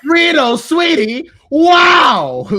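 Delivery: high, loud, slow hammed-up shout; over-the-top shouted line. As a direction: belt it like a desperate theatre kid. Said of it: "in my mirror talking about".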